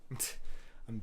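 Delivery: stumbling on the words with a sigh; stuttering. Real, not acted. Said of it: "stuttering my own words".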